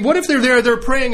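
ordinary delivery

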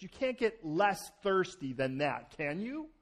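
usual speaking voice

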